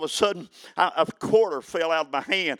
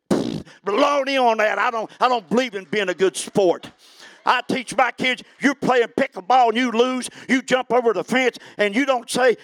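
high, loud, building sermon shouts; full-force preaching; 7 semitones higher, 6 dB louder than usual. Acted. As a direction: preach it hard and loud to the congregation.

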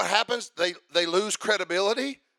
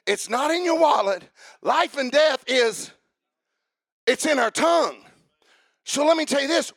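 forceful, raised preacher's delivery; emphatic preaching; 4 semitones higher, 5 dB louder than usual. Real, not acted. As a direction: drive each point home to the congregation.

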